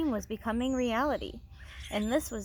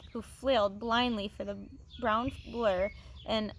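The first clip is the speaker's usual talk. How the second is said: ordinary delivery, nothing marked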